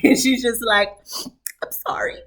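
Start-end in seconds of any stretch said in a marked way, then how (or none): 1.6-2.2 whispered, teary apology; mock weepy whisper; tearful whisper. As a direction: breathe it out like someone crying. Crying Princess quoted apologizing.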